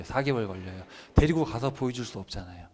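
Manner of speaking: usual speaking voice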